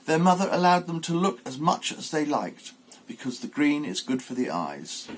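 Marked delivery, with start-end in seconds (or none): none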